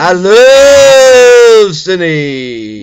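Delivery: big drawn-out slow declaration; stretched-out loud I love Cindy; loud gushing. Real, not acted. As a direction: belt out your love for her, slowly. Gushing about Cindy loudly.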